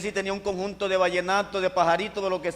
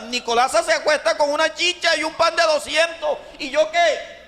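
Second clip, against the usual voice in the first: indignant complaint; 8 semitones higher than usual. Retold or imitated. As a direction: let the grievance build into a near shout. Complaining to God about hunger.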